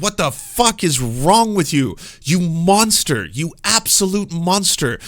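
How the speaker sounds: imitating an outraged scolder; mock angry yelling. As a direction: act out righteous fury, stretching the words. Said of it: "scream and yell and be like".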